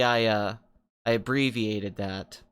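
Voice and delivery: ordinary talk, nothing marked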